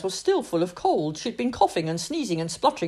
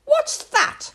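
put-on high disgusted child voice; horrified princess; 5 dB louder than usual. Princess eyes the chef's broth, high horrified squeal. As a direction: recoil at the bowl, much higher. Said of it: "The little princess was horrified".

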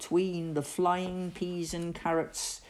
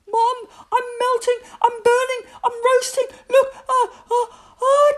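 put-on very high, loud boyish whine; squealing overheated panic; panicked wailing; 10 dB louder, faster than usual. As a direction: wail to mum, then gasp towards a sneeze. Overheated Victor panics, sneeze coming.

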